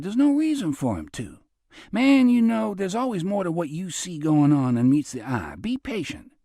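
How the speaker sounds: a breezy con man soothing his friend; Robert's easy voice. Acted.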